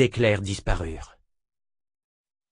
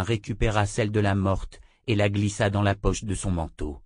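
ordinary delivery, nothing marked

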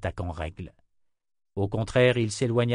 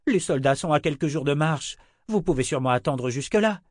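higher, pressing appeal from the crowd; calling out; 6 semitones higher, 3 dB louder than usual. A narrator's reading. Townsman asks his lord to delay, urgent call. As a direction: urge him to wait, voice raised a little. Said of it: "lança un homme".

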